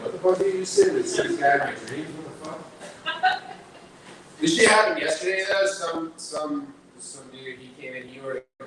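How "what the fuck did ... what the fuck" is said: alarmed customer. Retold or imitated. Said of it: "he's like".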